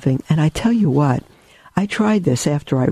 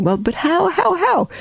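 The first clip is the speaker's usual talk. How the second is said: rising, exasperated how, much higher; pleading objection. Pretend. A doubting listener pressing Saint Francis: mock exasperated.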